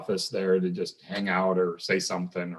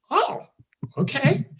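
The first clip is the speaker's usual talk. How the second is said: much higher, retold happy surprise; mock delighted surprise. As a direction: play the student thrilled about a free keg. Retold delighted reaction to keg.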